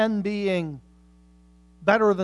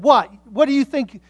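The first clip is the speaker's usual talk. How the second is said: retold indignation; 5 semitones higher, 11 dB louder than usual. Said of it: "became very indignant".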